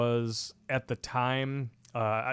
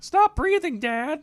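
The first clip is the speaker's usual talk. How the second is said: high squeaky mock teen girl, louder; mock daughter; 5 dB louder, slower than usual. Host mocks the co-host's daughter in a high squeaky voice. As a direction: play the bratty daughter.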